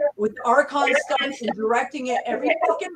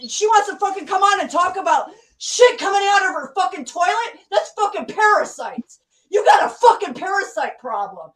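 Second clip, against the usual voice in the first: furious rant; 6 semitones higher than usual. Real, not acted. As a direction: rage at her, going up to a yell. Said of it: "calm down, [name]".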